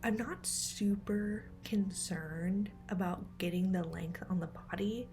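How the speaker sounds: hushed late-night whisper; quiet at night. Real, not acted. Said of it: "I'm trying to keep things a little quiet".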